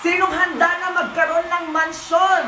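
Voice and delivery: high-pitched shout to the crowd; hype rallying. Acted. Recruiter promising mansions to the audience, shouted hype. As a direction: whip the audience up with a big question.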